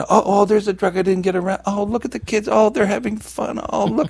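high, airy oohing over what was missed; mock wistful regret. Ex-user watching kids have fun, mock wistful. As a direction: coo enviously in a high put-on voice. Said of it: "that sense of, you know, feeling old".

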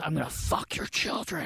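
slow, whispered, creepy threat; a hushed, retold threat from the rude duct cleaner; menacing whisper.

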